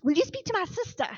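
imitating an amazed friend, high and loud; retold eager request. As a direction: burst out with the request as he did.

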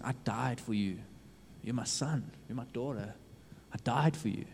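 soft, gentle, loving plea; tender God's voice. Acted. God tells his lost child he died for them, softly tender. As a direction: speak as God comforting his child, quietly. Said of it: "God is looking at you and saying".

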